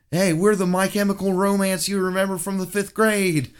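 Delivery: loud, high stage announcement; hyped band frontman. Retold or imitated. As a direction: shout it like a singer to the crowd. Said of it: "came out and said".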